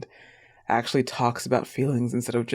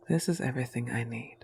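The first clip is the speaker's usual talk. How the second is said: soft whisper, a little quicker; 6 dB quieter, faster than usual. Acted. Girlfriend whispering contentment to her boyfriend, whispered. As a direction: breathe it close to his ear.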